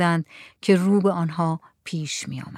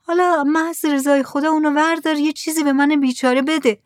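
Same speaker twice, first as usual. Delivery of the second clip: lightly voiced woman, higher, begging; pleading woman; 8 semitones higher than usual. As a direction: plead for something, anything. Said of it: "از وسط زنها صدایی گفت".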